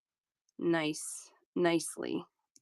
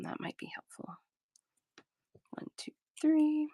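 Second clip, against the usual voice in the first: whispering; 7 semitones higher, 5 dB quieter than usual. Real, not acted. Talking to herself while wrapping.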